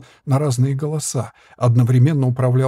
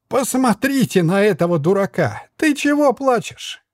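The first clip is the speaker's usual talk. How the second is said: very high, jeering puppet; mock the crybaby; mocking jester voice; 7 semitones higher than usual. A narrator's reading.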